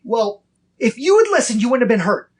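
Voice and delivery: put-on high, loud frustrated scolding of a hurt child; a father snapping at his hurt son; angry parent scolding. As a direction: scold him in a high exasperated voice. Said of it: "and get mad at him".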